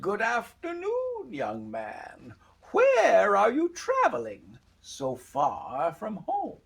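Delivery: put-on slow, swooping high old-woman voice; eerie old woman. Old woman greeting the young traveller, slow and eerie.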